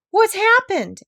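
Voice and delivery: high, loud alarmed question; startled cry. Acted. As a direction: shout up in sudden alarm. Shipwreck alarm.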